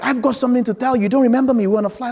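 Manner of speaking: high, loud, rushed excited caller; super excited. Retold or imitated. Cupcake lady phones her seatmate, super excited. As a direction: burst out with news, imitating an excited woman. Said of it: "super excited".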